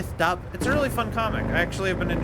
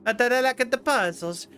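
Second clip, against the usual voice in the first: higher-pitched hammed-up Italian accent; bad Italian accent; 7 semitones higher than usual. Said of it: "terrible impression of an Italian accent".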